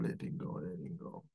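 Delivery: letting go, letting go whispered and dying away; whispered letting go fading to nothing. Shown as a demonstration. Repeated letting go while exhaling.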